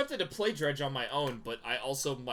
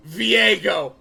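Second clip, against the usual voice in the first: dramatic voice; 7 semitones higher, 11 dB louder, much slower than usual. Retold or imitated. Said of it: "he just goes".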